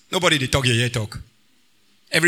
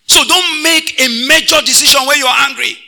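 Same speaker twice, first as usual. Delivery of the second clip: high, loud preached warning on major; 9 semitones higher, 6 dB louder than usual. Acted. Pastor warning the congregation, loud and high. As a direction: proclaim it loudly to the congregation.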